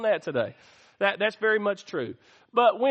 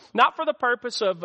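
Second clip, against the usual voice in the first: usual speaking voice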